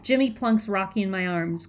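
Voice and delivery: plain reading voice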